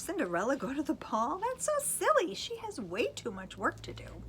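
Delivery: high mocking disdain; scoffing stepmother. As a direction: sneer at the very idea. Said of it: "said her stepmother".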